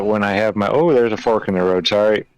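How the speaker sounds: normal talking voice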